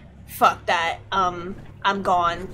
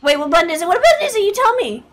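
high, loud, flustered demand; flustered urgency; 9 semitones higher, 5 dB louder, much faster than usual. Real, not acted. Gamer demanding the button from a friend, flustered and high. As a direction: fire it out in a high-pitched panic. Panicked asking how to play.

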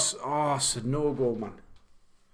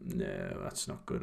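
low, breathy, deflated; dismayed groan; 9 dB quieter, slower than usual. Real, not acted. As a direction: sink into disappointment. Reacting to conceding in match.

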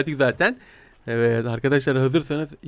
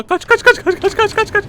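frantic squeal. Acted. Fleeing baby chase.

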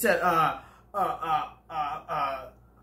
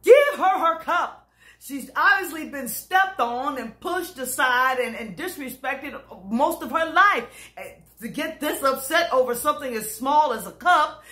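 snapping at her; 6 semitones higher than usual. Retold or imitated. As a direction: lose it and bark at her. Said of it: "and I snapped".

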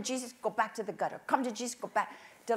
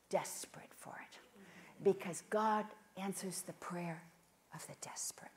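intense preacher's whisper; whispered conviction; 4 semitones lower, 9 dB quieter than usual. Real, not acted. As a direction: drop to a hushed whisper.

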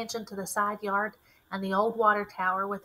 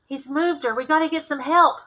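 higher, louder urgent voice; we must get help now; 5 semitones higher, 7 dB louder than usual. A narrator's reading. Urgent decision after finding blood.

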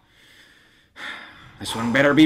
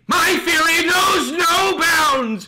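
high, slow, thundering shout; booming proclamation; 14 semitones higher, 3 dB louder, much slower than usual. Acted. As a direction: roar it like a wrathful deity. Dramatic outburst at Ron.

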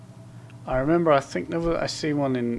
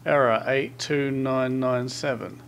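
ordinary talk, nothing marked

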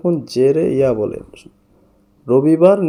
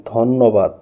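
ordinary talk, nothing marked

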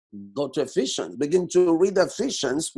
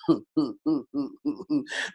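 soft chuckle; 4 semitones lower, 6 dB quieter than usual. Real, not acted. Laughs at his own line.

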